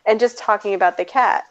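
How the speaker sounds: normal talking voice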